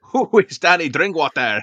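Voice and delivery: high, loud mock Italian voice, hammed up; bad Italian accent. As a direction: ham up a cartoon Italian like Monty Python. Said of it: "That was a very bad Italian accent".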